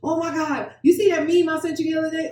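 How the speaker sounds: high-pitched imitation of gushing small talk; excited chatter. Partners sharing movies and memes in bed, mock excited chatter.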